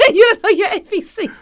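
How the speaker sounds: high, loud, laughing through it; laughing tease. Real, not acted. Teasing friend while laughing.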